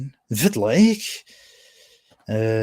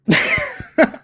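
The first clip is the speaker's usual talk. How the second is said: high, sudden laugh; a real laugh that shoots up high; burst of laughter; 8 dB louder than usual. Real, not acted. Streamer laughs at the clip, high and loud.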